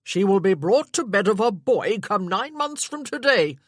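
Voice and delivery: roaring. Acted. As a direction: bellow it across the room. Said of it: "roaring his comment after his retreating spouse".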